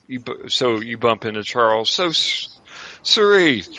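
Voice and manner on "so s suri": high, loud welcome in character; effusive host greeting. Acted. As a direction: greet an arriving guest with gushing delight. Game master voices Charles greeting Suri.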